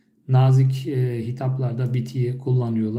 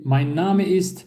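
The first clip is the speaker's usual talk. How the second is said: Mein Name ist said slowly and clearly; slow model phrase; 4 semitones higher, slower than usual. Shown as a demonstration. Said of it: "bu da bir soru tipi". (yavaş ve net örnek cümle)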